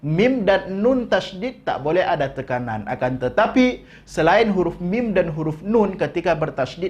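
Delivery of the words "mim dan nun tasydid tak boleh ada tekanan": normal talking voice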